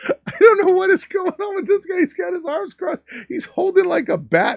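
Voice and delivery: high-pitched crying laugh through the words; laughing squeal. Real, not acted. Laughing bewildered at photo.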